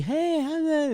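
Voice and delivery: friendly dog greeting. Retold or imitated. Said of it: "you're supposed to be".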